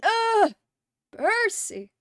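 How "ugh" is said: loud frustrated groan. Real, not acted. Outburst on stream.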